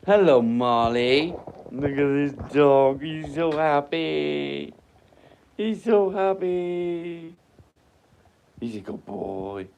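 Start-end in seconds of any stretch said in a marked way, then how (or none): 0.1-7.3 high, slow, sing-song voice for the dog. Real, not acted. Talking to the dog.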